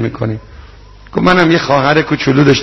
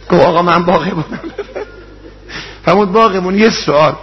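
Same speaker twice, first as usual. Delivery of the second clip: raised, tearful rowze recital to the congregation; mourning sermon; 5 semitones higher than usual. Acted. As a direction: preach the tragedy at full force, voice straining. Rowze mourning recital at full force.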